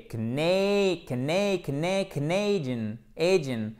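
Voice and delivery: "Cana-" drawn out as "k'NAY", building to "Canadian"; syllable drill. Shown as a demonstration. Teacher drilling students up to "Canadian", stressed long diphthong. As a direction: repeat "Cana-" with long stressed "nay", then complete "Canadian". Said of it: "Accent tonique sur la deuxième syllabe".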